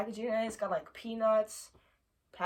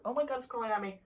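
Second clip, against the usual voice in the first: normal talking voice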